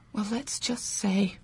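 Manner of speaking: hushed and slow, letting a secret slip; coy whispered hint; secretive whisper. Acted. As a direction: drop to a whisper as if someone might overhear. Hinting at a secret lover.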